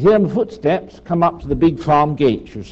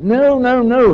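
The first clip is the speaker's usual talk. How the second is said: dog pulling back; 7 semitones higher, 4 dB louder than usual. Retold or imitated. Dog refusing to leave smell.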